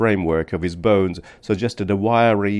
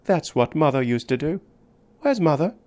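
put-on higher child voice asking innocently; small child wondering where mother is; little girl; 7 semitones higher than usual. A narrator's reading. Little girl to the man asking for mother, high innocent child voice.

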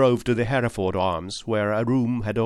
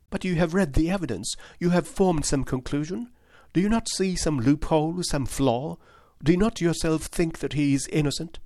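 put-on higher, pleading young lady's voice; earnest young woman; 7 semitones higher than usual. Miss Turner insisting to Holmes that James is innocent, higher earnest voice. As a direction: plead for James with eager conviction.

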